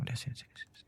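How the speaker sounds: hushing her, whispered and soft; calm her down under your breath; soothing whisper. Acted. Husband comforting his crying wife. (bisikan menenangkan istri yang menangis)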